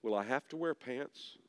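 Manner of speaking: soft, low, mock-solemn question; quiet, earnest retold question; solemn child's question. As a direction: ask it very solemnly, quoting a small boy. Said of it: "he says very solemnly".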